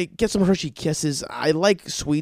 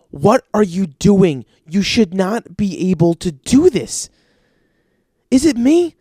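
slow, louder disbelief; exasperated; 3 semitones higher, 5 dB louder, slower than usual. Retold or imitated. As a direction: push back, annoyed and baffled. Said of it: "And I'm like".

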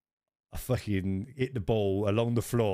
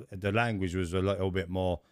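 ordinary talk

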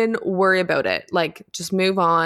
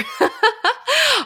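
high giggling burst; burst of laughter; 4 dB louder than usual. Real, not acted. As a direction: laugh it off after tripping up. Laughs at her own stumble.

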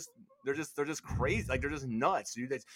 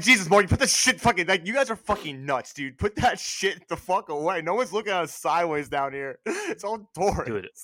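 exasperated; 5 semitones higher, 5 dB louder than usual. Retold or imitated. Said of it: "I'm like".

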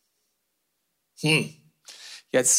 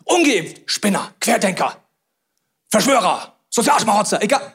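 mock scorn; 3 semitones higher than usual. Mock name-calling of unvaccinated.

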